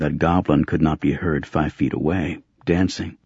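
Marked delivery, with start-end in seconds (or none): none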